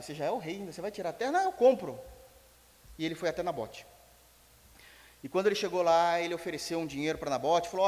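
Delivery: ordinary talk, nothing marked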